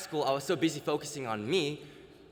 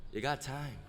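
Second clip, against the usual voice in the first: soft squirrel murmur in the ear; hushed, lightly acted whisper; whispered reassurance; 6 dB quieter than usual. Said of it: "and whispers".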